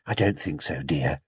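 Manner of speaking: put-on calm a child; gentle mother. Mother answering her little boy, soft and gentle.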